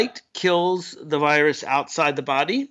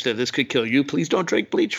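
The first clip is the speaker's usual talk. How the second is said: usual speaking voice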